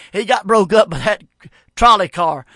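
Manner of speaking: normal talking voice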